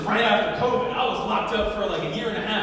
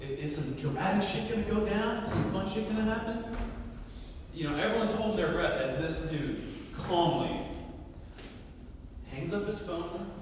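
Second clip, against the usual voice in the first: normal talking voice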